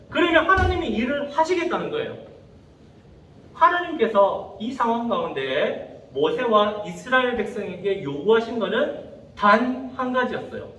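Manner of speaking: ordinary talk, nothing marked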